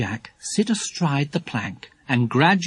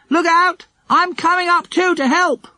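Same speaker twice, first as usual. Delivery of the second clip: high, loud call from below; 5 dB louder than usual. A narrator's reading. Jack to friends climbing above, calling up. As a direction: call up a warning in a high, raised voice. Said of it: "called Jack from below".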